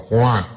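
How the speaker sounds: a short high "Cuac" quack; high duck quack. Acted.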